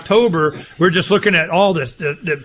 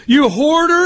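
much higher, mocking accusing question; mock accusation; 11 semitones higher, 3 dB louder than usual. Critics scolding a reseller, mock accusing. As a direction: sneer it as the scolding crowd. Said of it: "And they'll go".

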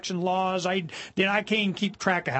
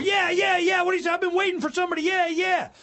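eager supporters; 12 semitones higher than usual. Retold or imitated. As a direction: ham up the cheering fans.

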